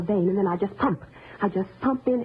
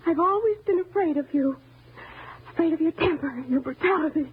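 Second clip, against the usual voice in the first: high, overwrought outpouring; anguished, high-pitched reproach; distraught confession; 7 semitones higher than usual. Acted. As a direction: let years of fear spill out. Confesses years of fear of him.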